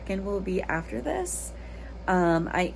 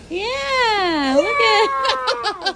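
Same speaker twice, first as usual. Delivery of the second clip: excited greeting; 13 semitones higher, 8 dB louder, much slower than usual. Real, not acted. Mother greeting kids' bus driver.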